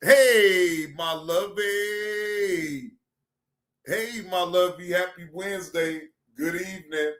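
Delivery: tender greeting. Real, not acted. Greeting a viewer, low and tender. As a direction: greet her sweetly, low.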